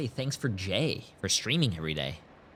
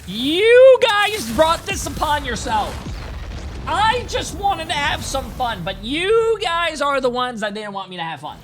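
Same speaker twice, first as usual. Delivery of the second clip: hammed-up furious villain tirade; mock villain rant; 14 semitones higher, 7 dB louder than usual. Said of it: "take out my frustration".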